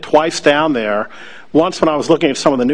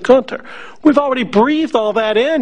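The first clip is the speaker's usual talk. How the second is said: exasperated retort; 10 semitones higher than usual. Retold or imitated. Telling Gunter the fumes are already inside, high and exasperated. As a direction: pitch it up, laughing him off as the fumes are already in.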